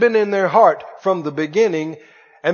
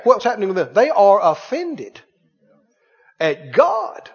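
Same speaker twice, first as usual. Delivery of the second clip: ordinary talk, nothing marked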